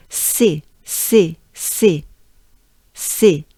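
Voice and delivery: C said as a short "sé"; punchy repeated C with the é of café; French syllable drill. Shown as a demonstration. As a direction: model C as French "sé". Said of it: "We wanna do C".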